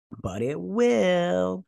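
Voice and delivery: high, very slow sing-out; drawn-out insistence. Acted. Elf answering his doubting friend, drawn-out and high. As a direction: stretch it out high, a mock hopeful reply. Acting lovestruck elf's reply to friend.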